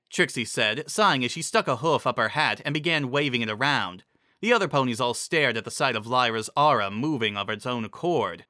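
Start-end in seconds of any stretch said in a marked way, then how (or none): none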